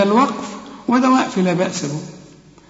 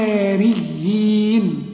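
للحواريين chanted slowly; slow recitation; slower than usual. Shown as a demonstration. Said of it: "علشان تقول للحواريين".

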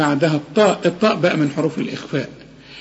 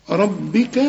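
ordinary talk, nothing marked

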